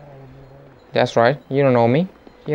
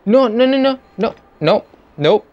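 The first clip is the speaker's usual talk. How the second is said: high, quick string of protests; panicked denial; 8 semitones higher than usual. Pretend. Player protesting his innocence in a game, high panicked denial.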